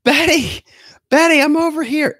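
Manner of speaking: high, loud granny shout; old woman yelling back. Retold or imitated. Sharon answers Betty across the parking lot, high loud yell. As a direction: holler back at her friend, shrill and high. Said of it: "I hear Sharon yell".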